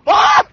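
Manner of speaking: sudden loud mock scream of fright; startled scream. Apostles seeing Jesus appear, loud startled yelp. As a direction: shriek like seeing a ghost. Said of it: "And they're like".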